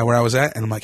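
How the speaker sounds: usual speaking voice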